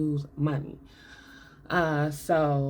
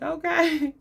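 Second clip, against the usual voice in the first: unimpressed okay; 10 semitones higher, 4 dB louder than usual. Retold or imitated. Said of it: "I would have been like Stack, like".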